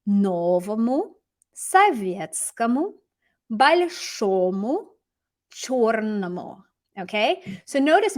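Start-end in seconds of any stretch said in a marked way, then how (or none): 0.1-6.6 новому, советскому, большому, чёрному drawn out slowly, each ending clear; slow model readings. Shown as a demonstration. Teacher revealing answers, slow careful dative forms. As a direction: read each answer slowly so learners can repeat. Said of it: "it's gonna come out the other side as".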